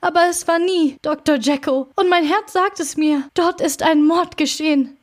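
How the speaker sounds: high, urgent, distressed protest; desperate servant insisting on what he knows; impassioned outcry. A narrator's reading. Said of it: "rief Poole".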